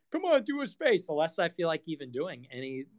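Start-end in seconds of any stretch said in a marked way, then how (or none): 0.1-1.1 higher, wheedling fan voice; mock pestering fan. Streamer mocking repliers begging for a space, high wheedle. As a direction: nag like a pushy fan. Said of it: "The more people bug me in my replies".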